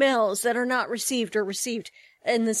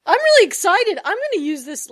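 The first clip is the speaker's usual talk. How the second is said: much higher and louder with excitement; excited; 9 semitones higher, 8 dB louder than usual. Real, not acted. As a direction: burst out with real delight. Delight at the paper tube.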